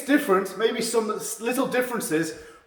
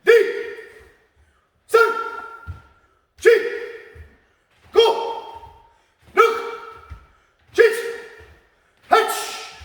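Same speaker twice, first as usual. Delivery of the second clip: sharp, high bursts on each count; power count; 13 semitones higher, slower than usual. Shown as a demonstration. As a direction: fire off each number short and high, long pauses between. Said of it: "your spin power".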